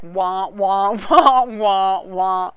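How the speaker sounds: loud mocking "wah, wah"; a braying, loud, mock sad trombone. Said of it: "Debbie Downer".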